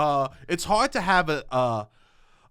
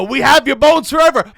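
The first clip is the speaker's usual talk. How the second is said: booming mock-sinister church voice, higher and louder; 7 semitones higher, 10 dB louder than usual. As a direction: loom over them like a spooky church. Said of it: "They go".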